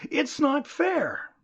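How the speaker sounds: high, slow aggrieved complaint; complaining. Retold or imitated. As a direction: play the wronged man protesting, drawn out and high. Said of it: "he'll be complaining".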